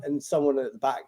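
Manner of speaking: ordinary delivery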